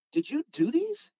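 high-pitched impressed question; retold amazement. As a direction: ask it in acted wonder, pitch jumping up. Amazed question about customized cars, retold.

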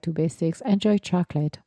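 normal talking voice

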